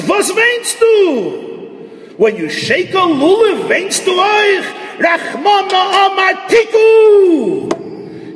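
high, slow, shouted Yiddish rebuke; a rabbi chiding the weeping shofar blower, shouted high; father's stern rebuke. Retold or imitated. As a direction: cry out at him, why are you weeping.

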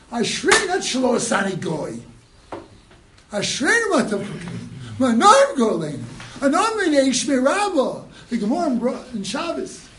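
high, loud, pressing sermon voice, rising to shouts; fiery preaching. Acted. Rabbi rebuking the congregation about priorities, preaching at full force. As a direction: hammer the point home to the congregation.

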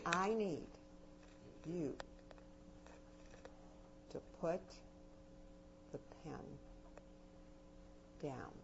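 quiet and firm, pause between every word; soft paused command. Shown as a demonstration. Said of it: "And then I paused between".

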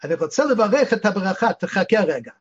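quick everyday Israeli chatter; fast Israeli Hebrew. Retold or imitated. Boy asking his friend to wait, quick Ivrit. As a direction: rattle it off quickly in modern Ivrit. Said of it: "they say".

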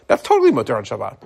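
ordinary talk

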